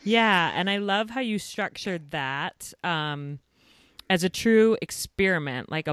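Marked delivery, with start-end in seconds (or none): none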